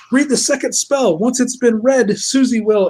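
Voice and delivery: high, pressing voice; mock urgent instruction. Pay pig urging the second spell, mock urgent. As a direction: urge them on in a put-on voice.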